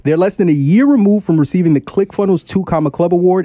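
big showman announcer intro; ringmaster. Acted. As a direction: ringmaster welcoming the crowd. Show intro in ringmaster style.

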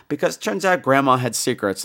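ordinary talk, nothing marked

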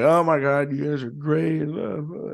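lightly imitated drunk patron; a hint of a rambling drunk, trailing into blah-blah. Drunk patron praising the band after the show, lightly imitated. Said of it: "very typical drunk patron".

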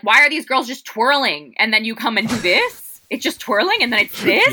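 exasperated mock disbelief; incredulous. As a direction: act baffled at the twirling. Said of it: "You're like".

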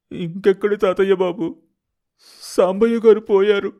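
high, loud cry of grief; grieving lament. Acted. Man telling the boy his grandfather died, loud lament. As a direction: wail the news of a death. Announces grandfather's death, then wipes eyes.

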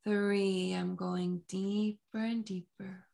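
soft, slow hypnotic induction; slow hypnotist voice. Acted. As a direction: lull them down gently and slowly. Hypnotic countdown continues.

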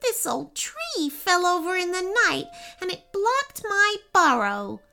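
high, plaintive small-animal voice; upset chipmunk. A narrator's reading. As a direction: complain woefully as a little chipmunk. Chipmunk explains its misfortune, upset.

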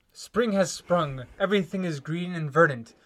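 usual speaking voice

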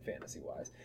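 ordinary delivery

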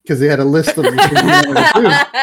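can't keep it together as you explain; cracking up while explaining the joke to friends, laughing; laughing while talking. Real, not acted. Cracking up retelling the clip.

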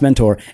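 "mentor" with a full -TOR ending; "mentor" ending in a rounded OR, not "ter"; mock-correct pronunciation. Shown as a demonstration. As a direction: say "mentor" as MEN-tor, the last syllable a clear "tor". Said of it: "it's pronounced mentor".

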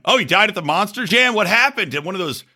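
high, eager disbelief, hammed up; a pretend friend hungry for crash details; mock shocked gossip. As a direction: gasp it out like juicy gossip. Imagined friend gossiping about death.

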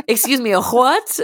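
rising disbelief; high bewildered question. Pretend. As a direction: ask in shocked confusion. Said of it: "acted reply".

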